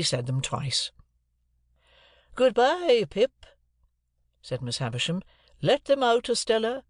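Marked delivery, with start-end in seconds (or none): 2.4-6.8 high, loud dismissal; imperious Miss Havisham. A narrator's reading. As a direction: call it out as a commanding lady.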